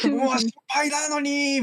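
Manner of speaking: high, drawn-out imitated protest; mock plaintive outburst. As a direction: play up the complaint, pitched high and stretched. Acted outburst over a remembered scolding.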